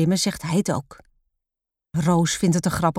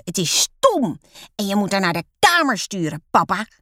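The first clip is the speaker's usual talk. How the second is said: put-on cross child's demand, understated screech; screeched complaint; 3 semitones higher than usual. A narrator's reading. Lotte wanting Roos sent to her room, mildly screeching. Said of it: "krijst Lotte".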